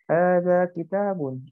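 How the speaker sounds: Hadza baitun drawn out slowly, each syllable clear; slow model reading. Shown as a demonstration. Teacher modelling Arabic for the online class, slow and clear. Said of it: "ini buku".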